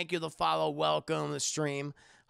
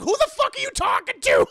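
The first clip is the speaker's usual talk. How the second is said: much higher, louder outraged yell; shouting; 13 dB louder than usual. Acted. Yusuke yelling at whoever is talking about the next episode. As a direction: bellow at the narrator. Yusuke yells at fourth wall.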